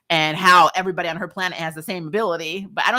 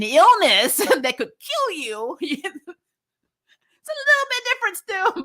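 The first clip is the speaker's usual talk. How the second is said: laughing retort; 12 semitones higher, 4 dB louder, slower than usual. Retold or imitated. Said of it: "And I'm like".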